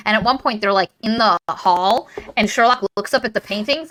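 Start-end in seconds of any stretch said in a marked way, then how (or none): none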